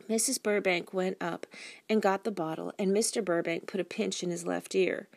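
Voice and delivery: plain reading voice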